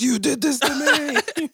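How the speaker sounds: very high, slow accusing mom; mom impression. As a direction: accuse dramatically, like a wounded mom. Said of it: "points at her stretch marks".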